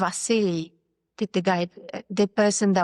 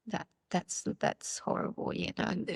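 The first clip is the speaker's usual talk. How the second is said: dropped to a near whisper, much softer; hushed dismay; 10 dB quieter than usual. Real, not acted. Telling the interviewer about the slur, in hushed dismay. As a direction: barely voice it, appalled. Recalling a slur against a sister.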